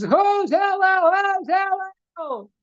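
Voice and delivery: very high, slow screamed question; mock scream. Joker screaming again for Hillel, high slow mock scream. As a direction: screech it out to needle him. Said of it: "the guy screams".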